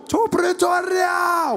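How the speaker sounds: high, drawn-out crowd shout; long fervent chant-back; riled-up supporters. Retold or imitated. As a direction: answer like a roaring rally. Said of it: "how riled up his supporters were".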